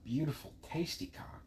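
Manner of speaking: breathy whisper. Real, not acted. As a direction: whisper it close. Crude joke whispered.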